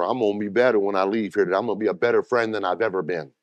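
brisk model line for the congregation to repeat; fast recitation. Shown as a demonstration. Pastor models a quick line for the neighbor, rapid. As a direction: rattle it off quickly, as a cue for the flock. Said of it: "Tell that to your neighbor".

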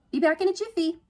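high, loud chirp; endlessly cheerful. A narrator's reading. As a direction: sing out a cheery goodbye.

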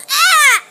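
loud, high yelp of disgust; disgusted scream. Real, not acted. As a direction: let out a gross-out shriek. Disgust after rotten egg.

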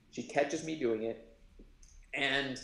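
ordinary talk, nothing marked

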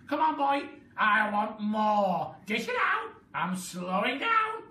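very high, loud cackling crone voice; a mad old woman crowing in triumph; Grandma crowing as she grows, shrill glee; wild old grandma. A narrator's reading. Grandma character voice.

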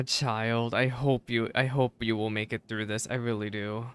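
ordinary delivery

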